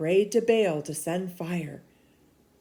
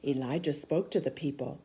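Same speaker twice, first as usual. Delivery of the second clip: plain reading voice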